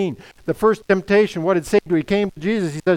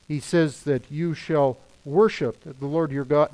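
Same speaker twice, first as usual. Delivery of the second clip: usual speaking voice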